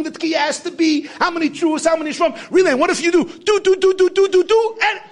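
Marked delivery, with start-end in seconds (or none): 3.1-4.8 higher, louder sung "do, do" blasts; imitated shofar blasts. Preacher imitates the shofar's Shvarim blasts, higher and louder. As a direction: toot each note like a shofar.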